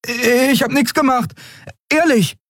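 very high, squeaky protest of innocence; innocent Miko. A narrator's reading. Miko denies touching the machines, high innocent protest. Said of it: "Der hob unschuldig die Hände".

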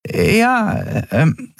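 very high, hesitant reply; unsettled stammer. A narrator's reading. Tiny Yadi facing Kero's stare, unsettled. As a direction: falter under a piercing look, high-pitched. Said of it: "versuchte sich nicht ... verunsichern zu lassen".

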